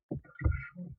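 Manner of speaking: ordinary delivery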